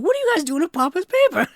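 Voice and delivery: high, loud, fast mock reproach; Charlie walking in.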